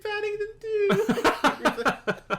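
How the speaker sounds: very high, long-drawn loud wail; laughing, hammed-up blubbering; mock crying. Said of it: "like, crying".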